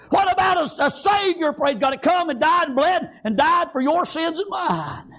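high, shouted revival cadence; fired-up preacher in full cry; full-force preaching. Real, not acted. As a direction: shout it out in driving, rhythmic phrases.

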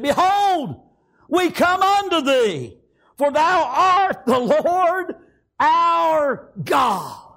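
high, slow, fervent proclamation; preaching at full force. Real, not acted. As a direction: cry the scripture out with long drawn words. Cries out the scripture in preaching.

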